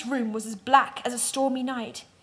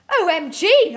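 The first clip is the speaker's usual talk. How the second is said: higher and louder squeal of excitement; excited; 7 semitones higher, 8 dB louder, slower than usual. A narrator's reading. Teen girl hearing Edward is a vampire, excited squeal. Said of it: "I said excitedly".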